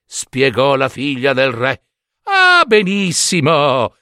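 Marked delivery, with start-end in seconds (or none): 2.2-4.0 high, slow, drawn-out delight; delighted exclamation. Acted. Giambabbeo hears about the roasting, delighted. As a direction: stretch it out with simple-minded glee. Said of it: "esclamò Giambabbeo".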